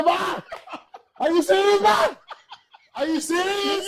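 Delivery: very high, loud yelling; hammed-up outrage at Ma; incredulous son. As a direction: shriek in comic disbelief. Son outraged at salty-egg confession.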